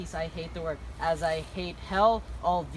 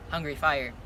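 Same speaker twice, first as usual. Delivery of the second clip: ordinary delivery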